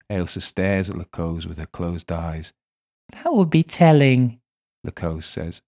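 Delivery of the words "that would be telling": put-on high, loud little girl voice; a spirit child speaking through a medium; eerie child spirit, much higher; little girl's voice. A narrator's reading. Madimi teasing.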